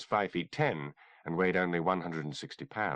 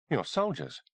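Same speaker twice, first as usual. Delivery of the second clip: dazed, stupid; 5 semitones higher than usual. A narrator's reading. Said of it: "he said, somewhat stupidly".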